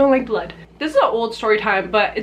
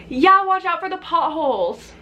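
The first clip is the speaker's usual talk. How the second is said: retold warning; 7 semitones higher than usual. Friend calls out a warning.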